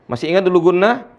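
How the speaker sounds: ordinary delivery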